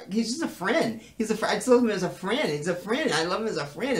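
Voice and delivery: high, insistent mock pleading; pleading protest. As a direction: keep insisting, pitch swooping up. Acted protest to jealous Justin.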